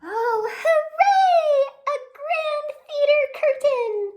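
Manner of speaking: high, loud, slow cry of joy; squealing delight. A narrator's reading. Squirrels cheer at finding a grand curtain, squealing with delight. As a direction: squeal like a thrilled little squirrel. Squirrels thrilled by new find.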